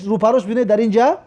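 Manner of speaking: ordinary delivery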